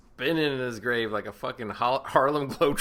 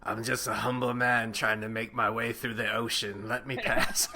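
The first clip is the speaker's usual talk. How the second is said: mock Jango Fett; 3 semitones lower, 4 dB quieter than usual. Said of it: "fucking Jango Fett".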